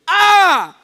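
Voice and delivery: high, loud whoop of joy; overjoyed Nigerian mum shrieking with delight; excited mother. Acted. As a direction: burst into jubilant shouting. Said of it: "she was so excited".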